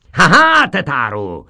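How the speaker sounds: high, loud crowing teacher; gloating schoolmaster. Acted. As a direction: crow over the catch.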